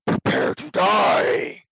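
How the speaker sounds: Space Marine battle yell. Pretend. Space Marine yelling over the mic at Halo players, hammed-up war cry. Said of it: "however I can do that, that accent before".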